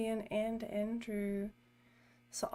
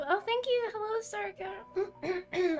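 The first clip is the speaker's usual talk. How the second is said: high, cracking with excitement; excited squeal; 9 semitones higher, slower than usual. Real, not acted. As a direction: voice giving out from excitement. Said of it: "I got so excited I lost my voice".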